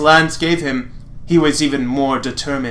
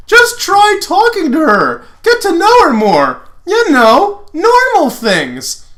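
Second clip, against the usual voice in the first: high, loud breezy advice, slow at the end; Lance voice; 14 semitones higher, 6 dB louder than usual. Acted. Lance coaching Lotor on dating, high and loud. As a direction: chirp it up high, drag out normal things.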